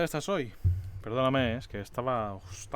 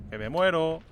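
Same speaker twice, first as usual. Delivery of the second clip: louder, urgent cry of panic; 3 semitones higher, 8 dB louder, slower than usual. Real, not acted. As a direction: blurt it out in a panic. Panicking during the game fight.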